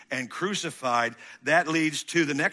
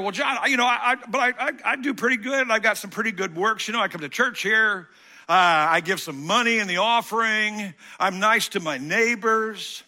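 higher, stammering self-justifying churchgoer; mock self-satisfied churchgoer; 7 semitones higher, 4 dB louder than usual. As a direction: play the smug churchgoer defending himself. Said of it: "You're like".

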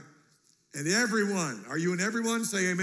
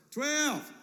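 normal talking voice

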